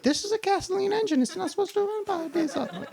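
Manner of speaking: very high, squeaky customer voice; protesting customer. Acted. Acted worried customer protesting to mechanic.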